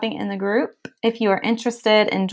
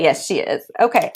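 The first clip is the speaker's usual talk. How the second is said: normal talking voice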